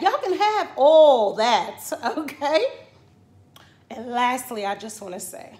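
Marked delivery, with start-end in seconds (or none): 0.0-2.6 high, loud, incredulous ranting; indignant rant. Real, not acted. Ranting at the vaccine maker, loud and indignant. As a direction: tear into them, disbelieving.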